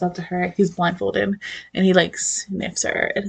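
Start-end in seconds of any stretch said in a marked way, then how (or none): none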